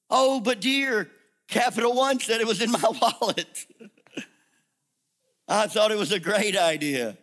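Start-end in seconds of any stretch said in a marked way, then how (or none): 0.1-3.7 mock innocent wife. Wife's excuse in joke skit.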